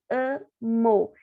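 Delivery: "amo" split slowly into a-mo; sounding out "amo". Shown as a demonstration. Teacher to pupils, sounding out the word. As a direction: stretch "amo", letting the M start the second syllable. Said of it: "Ele tá no meio da frase".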